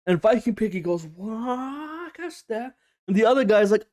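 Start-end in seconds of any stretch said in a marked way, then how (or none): 1.0-2.7 slow, hushed, higher disbelief; dazed stabbed friend. Retold or imitated. Viking Piggy realizing he's been stabbed, slow and dazed. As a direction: drawl it out like you can't believe it.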